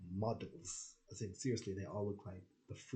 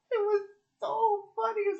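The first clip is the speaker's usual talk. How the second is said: squeaky laugh-talk; 13 dB louder than usual. Real, not acted. Laughing about the show.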